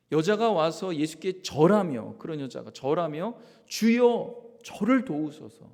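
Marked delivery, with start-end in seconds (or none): 3.7-5.7 crying out high and loud, then slow; loud desperate plea. Acted. Pastor voicing the Canaanite woman begging Jesus, loud plea. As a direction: beg for help with all your voice. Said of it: "소리를 내는 겁니다".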